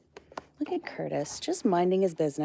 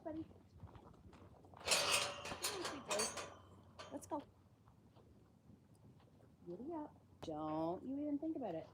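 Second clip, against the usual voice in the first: much higher, soft sing-song coaxing; coaxing pet voice; 4 semitones higher, 16 dB quieter than usual. Real, not acted. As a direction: gently lure the animal in. Coaxing an animal in quietly.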